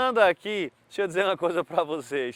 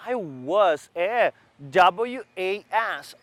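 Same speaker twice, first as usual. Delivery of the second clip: slow spelling; much slower than usual. Shown as a demonstration. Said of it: "spelling out was".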